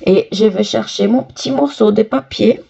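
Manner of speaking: ordinary talk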